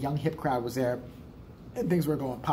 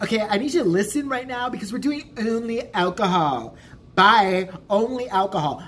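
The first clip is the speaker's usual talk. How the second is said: high, mocking imitation of a snotty server; rude bartender yelling; 5 semitones higher, 6 dB louder than usual. Bartender brushing off a Sprite order, snotty and raised. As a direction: talk down to the customer, sharp and dismissive. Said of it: "yelled at him".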